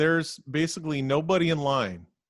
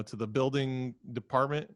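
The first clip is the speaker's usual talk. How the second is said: ordinary talk, nothing marked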